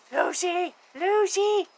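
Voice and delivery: squealed pet name; high pet call. Real, not acted. Calling to pet.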